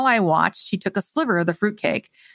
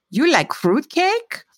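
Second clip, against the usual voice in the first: put-on slow, high Slavic-accented question; heavy Slavic accent; 9 semitones higher, slower than usual. A narrator's reading. Old woman questions visitor at the door, Slavic accent. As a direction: quiz a stranger in a thick Slavic accent. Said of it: "The voice was heavily accented, Slavic sounding".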